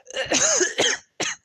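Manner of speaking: high, smoky coughing fit; coughing out of a smoky room. Acted. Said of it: "coughing is heard".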